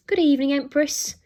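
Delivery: high, loud robot butler announcement; posh robot voice. A narrator's reading. Robot butler greets the boy as royalty, posh robot voice. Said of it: "announced in its posh robot voice".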